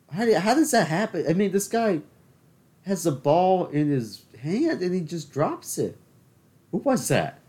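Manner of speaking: high, loud disbelief at a dropped ball; furious fan shouting; yelling at the TV. Real, not acted. Yelling at baseball on TV.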